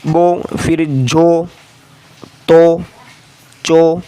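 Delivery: बो, जो, तो, चो each drawn out alone; teacher sounding syllables for pupils; slow syllable drill. Shown as a demonstration. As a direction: spell out बो जो तो चो slowly, one by one. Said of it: "इसको इस तरह पढ़ेंगे".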